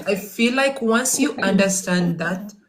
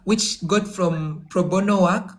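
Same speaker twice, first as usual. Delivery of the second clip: ordinary delivery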